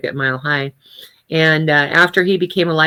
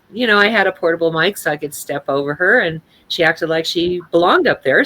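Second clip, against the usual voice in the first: ordinary talk, nothing marked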